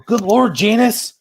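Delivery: high, drawn-out exasperated catchphrase; mock pissed-off exclamation. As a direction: act out exasperation, slow and high. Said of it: "say when I'm pissed off".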